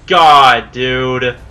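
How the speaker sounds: very loud, frustrated outburst; exasperated shout. Real, not acted. Gamer hit hard by enemy combo, loud exasperated shout. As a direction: explode in real exasperation.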